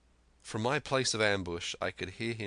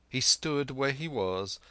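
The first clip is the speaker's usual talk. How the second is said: ordinary delivery, nothing marked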